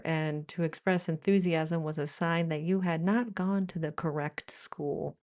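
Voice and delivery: normal talking voice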